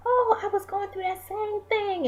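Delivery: high, excited gasp of recognition; suddenly relating, voice jumps up; surprised. Pretend. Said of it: "you are like".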